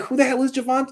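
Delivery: high, scoffing "who?"; mock the fans' disbelief at a nobody; incredulous mock crowd. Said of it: "Everyone would be like".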